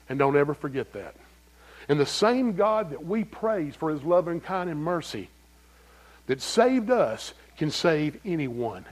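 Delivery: usual speaking voice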